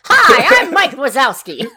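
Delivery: loud, high, hammed-up cartoon character; Mike Wazowski. Co-host answering a name joke, hammed-up cartoon impression. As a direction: burst out with a bad cartoon impression. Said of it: "That was a terrible Mike Wazowski impression".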